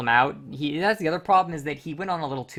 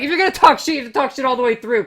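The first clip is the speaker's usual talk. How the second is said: higher, louder heated repeat of the rule; emphatic rant; 9 semitones higher, 7 dB louder than usual. Real, not acted. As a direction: hammer the point home, fired up. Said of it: "repeats the rule emphatically".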